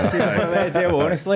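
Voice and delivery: chuckling as he starts; laughing. Real, not acted. Adam starts his jail story to the host, laughing.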